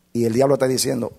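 ordinary talk

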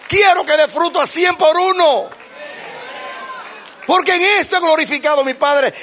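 God calling. Acted.

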